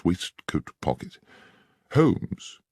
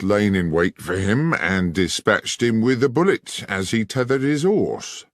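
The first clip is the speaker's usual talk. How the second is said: condescending sergeant; 4 semitones higher than usual. Acted. Sergeant lays out his theory to Holmes, condescending.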